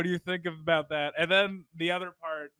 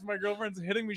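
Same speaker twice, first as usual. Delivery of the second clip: ordinary delivery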